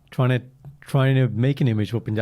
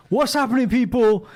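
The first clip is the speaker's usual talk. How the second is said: high, raised catchphrase call; imitated podcast intro; 12 semitones higher, 4 dB louder, slower than usual. Trying takes of the co-host's intro, high catchphrase call. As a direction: throw out a show opener, pitched way up. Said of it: "I tried a few takes".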